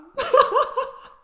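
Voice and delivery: high, loud laugh; burst of laughter. Real, not acted. Viewer overcome by the couple's date, high loud laugh. Overwhelmed by the scene.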